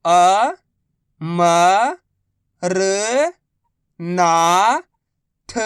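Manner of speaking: very slow, each syllable drawn out. Shown as a demonstration. Teacher sounding out a word for pupils, slow syllables. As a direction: spell the word out slowly for a child. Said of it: "मैं किस तरीके से".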